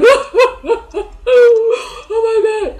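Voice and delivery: loud, high-pitched wailing sobs; full-on crying. Shown as a demonstration. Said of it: "I'm saying crying".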